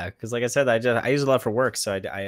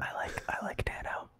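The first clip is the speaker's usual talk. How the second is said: very soft, voiceless whisper; sheepish whisper; 14 dB quieter than usual. Real, not acted. Co-host owning up after the Nano rant, sheepish whisper. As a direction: whisper a timid little confession.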